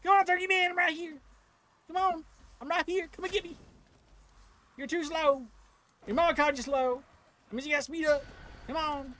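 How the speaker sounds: high mocking call to the turkey boss; taunting boss voice. Gamer taunting the turkey boss, high mock voice. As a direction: egg the monster on in a high put-on voice.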